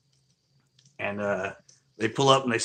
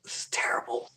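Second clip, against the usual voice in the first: whispered imitation of appalled viewers; 4 dB quieter than usual. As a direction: whisper it like a horrified audience member. Imitating silent, appalled livestream viewers.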